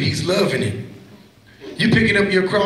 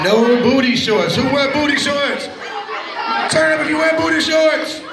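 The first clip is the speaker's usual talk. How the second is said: laughing call-out; 8 semitones higher than usual. Real, not acted. Youth preacher teasing the kids about booty shorts, laughing shout. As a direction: tease them with a laughing holler.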